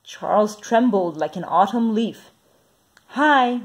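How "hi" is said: high, hearty coach's greeting; mild roar. A narrator's reading. Coach greeting a new player, mild hearty roar. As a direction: call it out big, pitched up. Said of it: "roared the coach".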